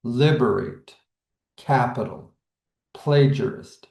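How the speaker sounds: "Liberate, capital, plagiarist", each stressed on its first syllable. Shown as a demonstration. Teacher giving dactyl examples, stress on first syllable. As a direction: hit the front, then two light beats: LIB-er-ate, CAP-i-tal, PLA-gia-rist. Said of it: "getting that stress at the first".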